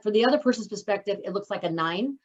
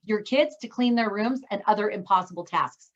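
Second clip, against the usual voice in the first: ordinary talk, nothing marked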